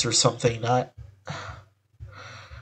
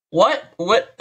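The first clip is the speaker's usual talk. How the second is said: double take; 7 semitones higher than usual. Real, not acted. Hearing Wheelie called racist.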